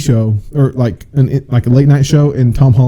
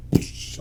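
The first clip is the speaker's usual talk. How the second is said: whoosh; 14 dB quieter than usual. Retold or imitated. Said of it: "it's like".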